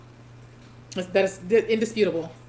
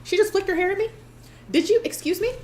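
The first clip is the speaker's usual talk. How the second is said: much higher, playful outraged disbelief; mock offended; 11 semitones higher, faster than usual. Reacting to a contestant's hair flick, mock-offended high gasp. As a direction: act scandalized by a hair flick, voice shooting up.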